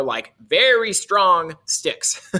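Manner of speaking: very strong sticks stretched out slowly; emphatic and slow. Shown as a demonstration. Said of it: "the ones that do have it are".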